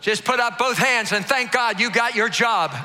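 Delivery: shouted high preaching, driving the crowd; preaching at full force. Acted. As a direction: preach it out loud to the whole room.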